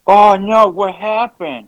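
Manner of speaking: high, loud mock dread; mock alarm. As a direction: gasp in pretend alarm.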